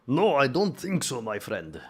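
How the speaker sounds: slow, lightly put-on German accent; a failed try at a German refusal. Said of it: "That's not a German accent".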